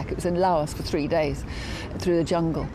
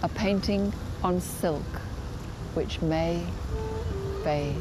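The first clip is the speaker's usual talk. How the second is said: poem recitation; slower than usual. Acted. Poet reciting her own poem from memory, slow and hushed. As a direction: let each line hang, then fade away.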